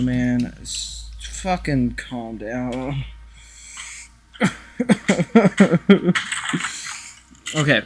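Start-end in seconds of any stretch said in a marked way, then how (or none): 4.3-7.1 sudden real laugh, high and loud. Real, not acted. Laughs at the microphone getting punched.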